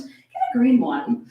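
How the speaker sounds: normal talking voice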